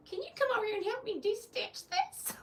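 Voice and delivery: mock old lady. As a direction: play a fussy old lady asking for help. Mock character asking for help.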